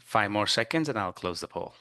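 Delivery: ordinary delivery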